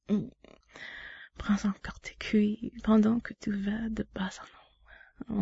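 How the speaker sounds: hushed, close to the mic; intimate whisper. Acted. To her partner, guiding him in a whisper.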